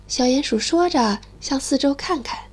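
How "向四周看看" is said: plain reading voice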